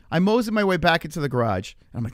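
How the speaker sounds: ordinary talk, nothing marked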